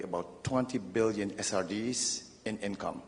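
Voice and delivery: ordinary delivery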